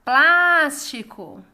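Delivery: "Plástico" called loud, PLÁS held long; calling, stressed PLÁS. Shown as a demonstration. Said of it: "Plás é a sílaba tônica". (chamando "Plástico", com o PLÁS forte e alongado)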